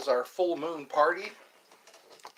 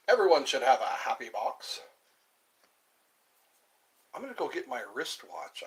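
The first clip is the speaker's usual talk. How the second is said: usual speaking voice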